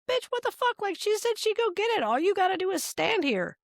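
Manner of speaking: very high, mock-incredulous squeal; hammed-up high-pitched inner critic; exasperated inner voice. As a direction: scold yourself in a shrieky high register. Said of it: "the other one is like".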